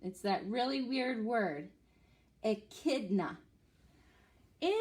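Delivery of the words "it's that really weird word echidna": ordinary delivery, nothing marked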